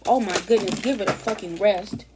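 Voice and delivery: loud, fed-up exclamation; exasperated. Retold or imitated. Voicing someone fed up with repeating problems, exasperated. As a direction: blurt it out, totally over it. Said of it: "and you're just like".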